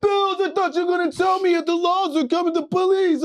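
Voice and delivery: very high, loud mock-crying complaint; hammed-up tearful yelling; anguished wailing. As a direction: wail accusingly after throwing up. Che retching and wailing at friend.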